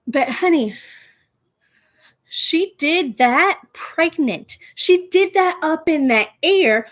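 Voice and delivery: mock-patient diva comeback; sassy. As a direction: clap back with drawn-out sass.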